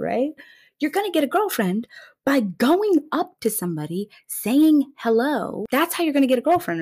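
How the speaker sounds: high, emphatic pep-talk voice; viral TikTok girl. Retold or imitated. TikTok girl advising lesbians, high emphatic lecture. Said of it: "And this girl is like".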